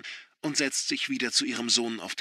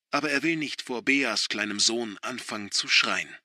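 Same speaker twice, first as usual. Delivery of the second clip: usual speaking voice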